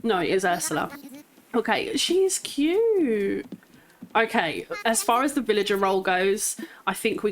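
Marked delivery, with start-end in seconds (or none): none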